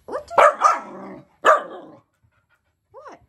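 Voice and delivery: very high, loud and drawn-out scold at the dog; slow high scolding. Real, not acted. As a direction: stretch it out high and loud at the pet.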